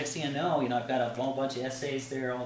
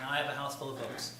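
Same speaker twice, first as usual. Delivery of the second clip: ordinary talk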